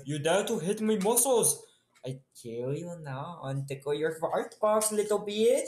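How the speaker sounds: high, hammed-up villain threat; offended muscleman. Huge brute whose muscles were hit, mock-menacing high voice. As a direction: threaten them as an affronted comic brute. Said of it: "And he's like".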